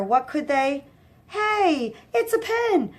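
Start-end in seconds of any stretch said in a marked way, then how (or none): 1.3-2.9 put-on much higher, childlike delight; excited dumb goon. A narrator's reading. Dumb goon finding a pen, much higher and delighted. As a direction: squeal over the shiny find.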